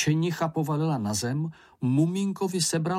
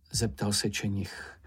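plain reading voice

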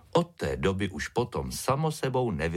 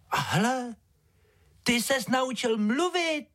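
put-on high little-girl wonder, drawn out; amazed; slower than usual. A narrator's reading. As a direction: marvel slowly in a high child voice. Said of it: "podivila se Ája".

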